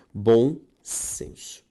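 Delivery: "Bom senso" drawn out slowly; slow emphasis. Shown as a demonstration. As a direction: stretch "Bom senso" as the lesson's key. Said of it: "teacher repeats key term".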